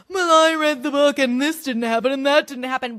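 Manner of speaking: high, nasal-free prissy mimicry of a book snob; mock a pedant who read the book; snooty mock quote. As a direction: mimic a smug reader whining. Mocking book purists.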